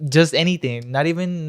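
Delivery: ordinary delivery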